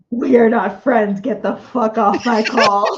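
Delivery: loud, slow mock outrage; mock angry. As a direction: pretend to kick them off, loudly. Mock quarrel reply.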